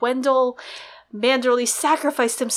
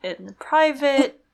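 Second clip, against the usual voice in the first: pleading aside; 3 semitones higher, slower than usual. Retold or imitated. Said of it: "And Davos is like".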